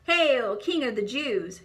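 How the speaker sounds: loud jeering cry; mocking yell. A narrator's reading. Soldier jeers at Jesus, loud mocking yell. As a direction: shout it to mock him. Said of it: "yelled a soldier".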